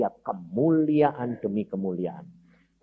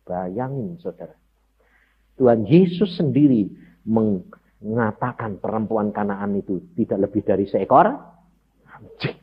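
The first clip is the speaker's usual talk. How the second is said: ordinary talk, nothing marked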